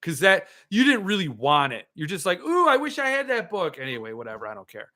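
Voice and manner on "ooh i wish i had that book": high-pitched mock longing; mock wishing. Teasing a viewer who didn't really want the book, mock wishing. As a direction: put on a wistful "ooh", pitched well up.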